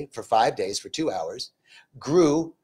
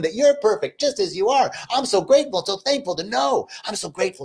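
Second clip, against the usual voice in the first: higher, impassioned prayer of thanks; rolling, insistent affirmations in a raised pitch; fervent gratitude prayer; 5 semitones higher, faster than usual. Real, not acted. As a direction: pray aloud with rising fervor, pitched up.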